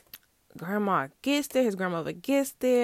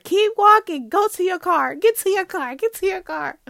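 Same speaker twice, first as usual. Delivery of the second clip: much higher and louder, urgent orders; urgent command; 10 semitones higher, 7 dB louder than usual. Retold or imitated. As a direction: shoo her to the car, fast and firm. Grandmother urging her to leave.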